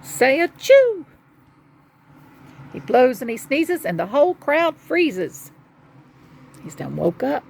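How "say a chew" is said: big, drawn-out storybook achoo; acted sneeze. A narrator's reading. Bear sneezing from a pepper flake, mock achoo. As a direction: build up to a high, loud sneeze.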